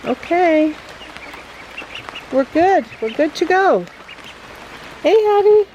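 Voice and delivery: high, bright pet talk. Real, not acted. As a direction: call sweetly to the pet. Calling to pet Hattie.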